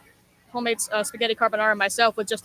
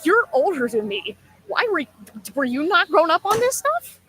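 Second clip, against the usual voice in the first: incredulous retort; 6 semitones higher, 5 dB louder than usual. Retold or imitated. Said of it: "I'm like".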